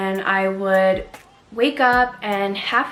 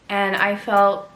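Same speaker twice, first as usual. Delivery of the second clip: ordinary talk, nothing marked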